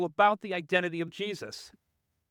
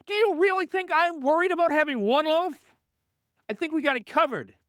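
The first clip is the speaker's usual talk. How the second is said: exasperated disbelief; 8 semitones higher, faster than usual. Retold or imitated. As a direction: scoff at them for worrying about bread. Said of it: "Jesus is like".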